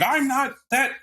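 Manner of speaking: high, flustered backpedaling voice; mock him stammering out a denial; flustered Xavier. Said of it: "it's like Xavier going".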